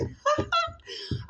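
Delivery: squeaky high laugh; a bright falsetto laugh; high giggle. Real, not acted. Laughs after ice cream.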